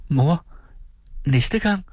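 very high, questioning daughter's voice; hopeful daughter. A narrator's reading. Child asks mother for reassurance. (håbefuldt barn, højt spørgende)

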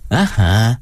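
knowing, feigned sympathy; false understanding. A narrator's reading. Performer nods along to the woman, falsely understanding. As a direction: pretend to understand completely. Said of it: "fuld af falsk forståelse".

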